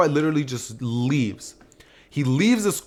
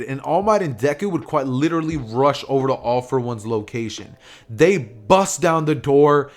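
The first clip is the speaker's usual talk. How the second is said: ordinary delivery, nothing marked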